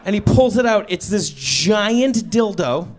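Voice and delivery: usual speaking voice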